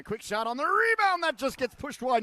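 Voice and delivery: high, loud burst of play-by-play; excited shot call. Real, not acted. Broadcaster calling a rebound chance, excited shot call. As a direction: surge up for the rebound.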